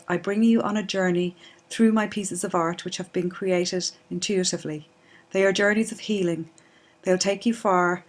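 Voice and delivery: loud, measured introduction read; scripted welcome. Acted. Artist welcomes listeners to her guided meditation, scripted introduction. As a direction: present a guided meditation series clearly and evenly.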